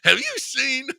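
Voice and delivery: squeaky high mock of the guest's voice; teasing the guest by aping his high voice; mocking falsetto. Host teasing the guest with a squeaky high imitation. Host mocks the Carol Cox voice.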